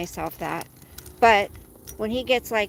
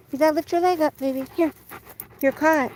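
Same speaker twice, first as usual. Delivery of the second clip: higher pet-talk coaxing; coaxing dog; 5 semitones higher, faster than usual. Real, not acted. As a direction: coax the dog to lift its leg.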